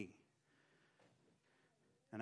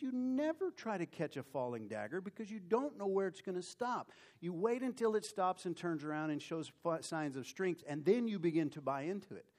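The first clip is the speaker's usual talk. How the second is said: raised pitch, hard-stressed warning; emphatic scolding; 6 semitones higher than usual. Retold or imitated. Boss warning broker about a falling dagger, emphatic. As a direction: lay down the rule forcefully. Boss lecturing him on the rule.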